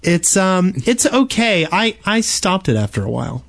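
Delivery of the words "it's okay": normal talking voice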